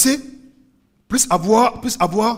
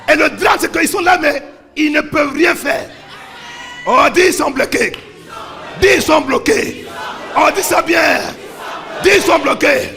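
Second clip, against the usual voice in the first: loud, rising preacher's cry to the crowd; fiery sermon delivery; full-force preaching; 6 semitones higher, 6 dB louder than usual. Acted. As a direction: preach it out, pushing each repeat harder.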